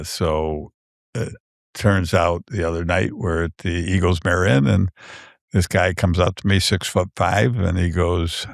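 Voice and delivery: normal talking voice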